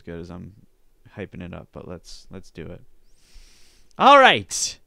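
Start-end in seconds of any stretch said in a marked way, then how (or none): 4.0-4.8 loud, high shout to kick off; revving up. Real, not acted. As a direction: shout it high to get started. Revving up to read the post.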